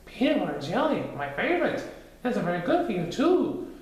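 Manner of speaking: high, happy little voice; Piglet delighted. Acted. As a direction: savour the favourite treat. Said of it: "Piglet said".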